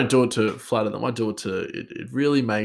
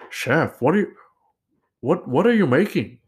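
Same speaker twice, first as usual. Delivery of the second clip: put-on high-pitched, louder take on a Nepali accent; 7 semitones higher, 4 dB louder than usual. Nepali chef questions colleague at work, imitated Nepali accent. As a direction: do your interpretation of a Nepali chef's accent. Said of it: "how I think he speaks".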